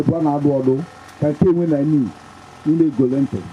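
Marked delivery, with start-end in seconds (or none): none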